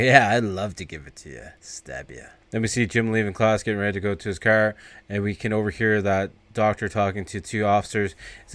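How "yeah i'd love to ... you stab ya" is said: low, sinking to a near-silent threat; menacing greaser. Retold or imitated. Greaser taunting teacher about his knife, dropping to a menacing low. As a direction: drop the voice into a cold threat.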